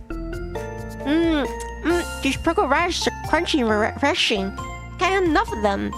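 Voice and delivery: high, relishing voice; delighted hungry guest. Acted. Guest raving about pickled radishes at dinner, delighted. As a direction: gush through a tasty mouthful.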